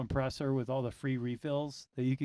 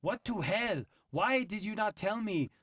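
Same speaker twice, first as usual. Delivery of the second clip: imitated angry wife; 7 semitones higher, slower than usual. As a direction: play the indignant German wife, stretching the words. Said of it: "she's super pissed".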